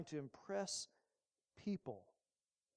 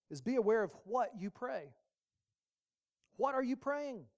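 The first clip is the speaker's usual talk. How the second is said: usual speaking voice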